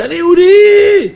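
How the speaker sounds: very high, loud, drawn-out cry; anguished shout. Retold or imitated. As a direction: cry it out slowly with all your soul. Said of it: "וצעקתי".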